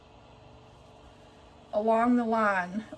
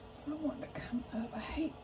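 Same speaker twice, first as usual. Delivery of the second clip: whisper; 2 semitones higher, 12 dB quieter, faster than usual. Real, not acted. Struggling with stuck pin.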